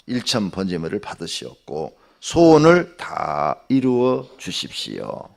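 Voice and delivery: slow, earnest pastoral prayer; closing prayer. Acted. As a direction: pour it out before God, slowly.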